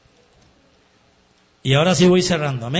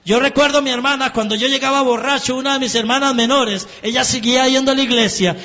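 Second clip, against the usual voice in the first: higher and louder, pressing preacher's build; fervent preaching; 7 semitones higher, 4 dB louder than usual. Acted. Preacher urging the congregation not to give up, fervent preaching. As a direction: preach it, climbing toward the climax. Preaching, rising to full force.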